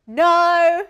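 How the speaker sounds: loud exasperated no; frustrated shout. Real, not acted. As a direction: yell at yourself. Frustrated at flubbed take.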